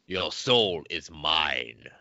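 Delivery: slow, low menacing villain; video game villain voice. Pretend. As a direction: drag it out like a fight-game boss. Mortal Kombat villain line quoted.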